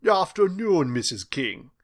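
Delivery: smooth British greeting, starting high and loud; British accent. A narrator's reading. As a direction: greet her in polished British English. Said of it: "his flawless British accent flowing over their ears".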